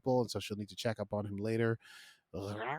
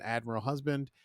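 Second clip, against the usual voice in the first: usual speaking voice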